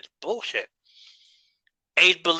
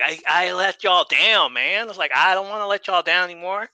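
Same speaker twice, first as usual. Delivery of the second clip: higher-pitched, put-on drawling accent; mock the wrestler's sorry plea in his accent; hammed-up imitation of the wrestler's accent; mock promo accent; 6 semitones higher than usual. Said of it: "especially in that accent".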